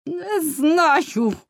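high, loud cry of pain. A narrator's reading. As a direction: cry out under torture, pitched high. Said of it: "зойкнула вона". (високий болісний зойк)

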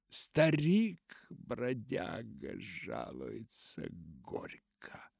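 slow line of verse fading to a whisper; muttered recitation. A narrator's reading. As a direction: murmur it slowly, let it die away. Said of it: "пробормотал он". (медленное бормотание, уходящее в шёпот)

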